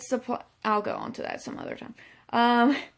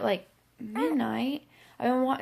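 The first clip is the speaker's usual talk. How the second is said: usual speaking voice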